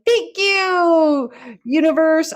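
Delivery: high, loud, beaming thanks to the sky; joyful thanks. Shown as a demonstration. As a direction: call your gratitude up to the universe. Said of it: "say thank you out loud".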